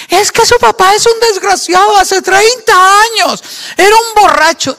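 furious shouted rant, high and loud; explosion of anger. Acted. Spouse exploding at someone's arrival home, furious high shouting. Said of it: "¿Exploto yo en ira cuando yo llegue?".

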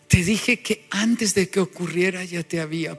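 quiet, low, gentle voice; calm him softly; Jesus soothing. Retold or imitated. Jesus reassures Peter, quiet and gentle. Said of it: "voy a parafrasearlo".

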